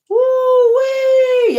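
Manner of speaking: high, loud whooping woo-wee; loud high exclamation at something eerie. Real, not acted. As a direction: let out a spooky yelp. Reacting to spooky image.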